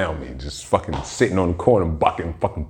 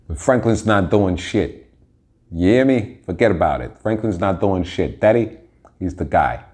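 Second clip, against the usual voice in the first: Italian-American accent. Real, not acted. Slipping into a mild Italian mobster accent about Snowfall. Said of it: "a fucking Italian voice just came out of me".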